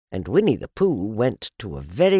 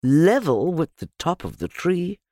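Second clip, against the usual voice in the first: usual speaking voice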